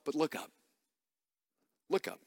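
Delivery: dropped soft, almost hushed; soft urging. Acted. Pastor softly urges the congregation to look up. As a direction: pull the room in quietly. Pastor's quiet closing point.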